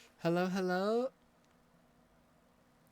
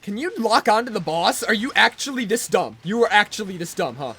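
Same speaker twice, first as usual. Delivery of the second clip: loud, exasperated shouting at the screen; angry yelling; 2 semitones higher, 9 dB louder than usual. Real, not acted. Gamer yelling at his dumb co-op partner, angry and loud. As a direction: yell at the useless AI partner.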